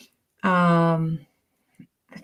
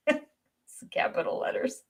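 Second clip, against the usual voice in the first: laughing whisper; 8 semitones higher, 10 dB quieter, slower than usual. Real, not acted. Laughing aside.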